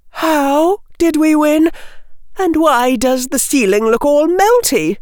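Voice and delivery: put-on high girlish questioning; puzzled schoolgirl. Hannah asking how they won, high puzzled girl. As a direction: play a bewildered young witch, pitched high.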